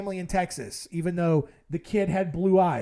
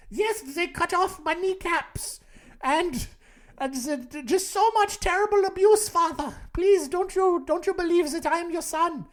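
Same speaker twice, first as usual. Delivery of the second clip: put-on thick German accent; 10 semitones higher than usual. Impostor begging father to believe him, German accent. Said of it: "this increasingly German accent you're talking with".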